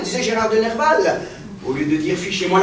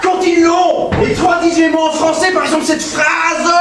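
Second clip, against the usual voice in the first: much higher, loud, relentless; furious shout; 6 semitones higher, 5 dB louder than usual. Acted. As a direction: drown her out. Professor shouting her down.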